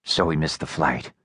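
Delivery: かなり低く抑えた男の声; 喉の奥から低く沈んだ声で; low voice. A narrator's reading. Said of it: "said Low Voice".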